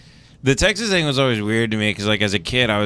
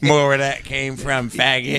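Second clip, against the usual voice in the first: cowboy impression; 3 semitones higher, slower than usual. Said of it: "the cowboy just looks at you".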